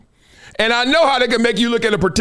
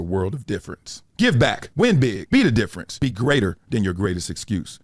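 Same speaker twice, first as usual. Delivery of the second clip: lower, smooth sponsor read, even and measured; 9 semitones lower than usual. Acted. Host reading a charity sponsor spot, smooth ad voice.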